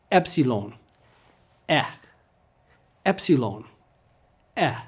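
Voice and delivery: "Epsilon" starting as in get, then a bare "Eh"; short E. Shown as a demonstration. Teacher leads repetition, short E epsilon. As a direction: alternate "EP-si-lon" with a short lone "eh". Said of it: "pronounced like a short E".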